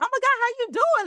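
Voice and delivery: high, fast, flustered greeting; shook and gushing. Retold or imitated. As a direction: blurt it out, caught off guard. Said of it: "I'm just kinda just shook".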